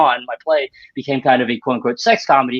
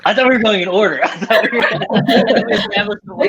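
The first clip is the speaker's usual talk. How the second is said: high, laughing confusion about the order; laughing protest; 9 semitones higher than usual. Real, not acted. Guest laughs, confused about the speaking order. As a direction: laugh through the mix-up.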